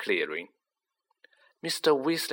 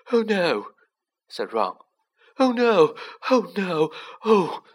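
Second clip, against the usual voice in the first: high, rising panic; frightened, high-pitched moaning; panicked dread; 8 semitones higher, 4 dB louder than usual. A narrator's reading. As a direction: wail it in mounting fear. Ron panics at approaching creature.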